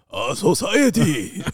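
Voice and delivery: slow, drawn-out monster growl; anime monster voice. Retold or imitated. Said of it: "a really deep anime monster voice".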